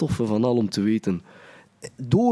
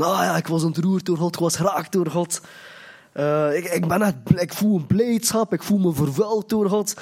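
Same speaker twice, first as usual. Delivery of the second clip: higher-pitched, gushing mock testimony; gushing new convert; 4 semitones higher than usual. New convert testifying about being touched by God, gushing. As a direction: imitate a new believer overflowing with feeling. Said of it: "getuigenissen van de christenen die net zijn bekeerd".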